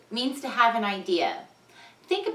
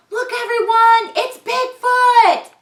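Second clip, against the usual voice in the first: high, loud, slow sung-out announcement; excited shout; 10 dB louder, slower than usual. Acted. Ben calling the whole town to see Bigfoot, loud and high. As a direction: belt it out like a kid who found a monster.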